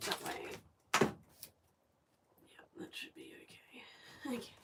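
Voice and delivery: soft unvoiced whisper. Real, not acted. Whispering while working.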